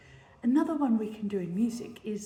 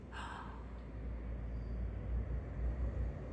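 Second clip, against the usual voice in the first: soft, long in-breath of wonder; held breath gasp; 5 dB quieter than usual. Shown as a demonstration. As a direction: gasp and hold it, waiting for the sun. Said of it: "everybody in the scene".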